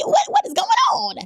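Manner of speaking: high-pitched squealed disbelief; retold shock. Woman retelling her night-time surprise to viewers, high squealed shock.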